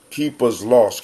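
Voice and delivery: ordinary delivery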